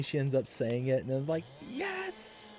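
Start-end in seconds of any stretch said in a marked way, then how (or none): 1.7-2.5 excited cheer. Retold or imitated. Celebrating baby saying it.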